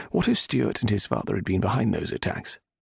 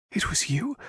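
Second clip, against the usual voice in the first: horrified; 11 semitones higher than usual. A narrator's reading. Heroine realizing the Viscount is guilty. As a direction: gasp it out in horror.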